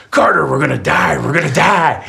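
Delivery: high, loud mock panic shout. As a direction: yell it like a terrified kid on the coaster. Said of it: "he looks back and he just goes".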